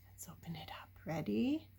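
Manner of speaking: hushed whisper. Real, not acted. Reader to the child before opening the flap, whispering. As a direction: keep it secret before the reveal. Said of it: "hushed before the reveal".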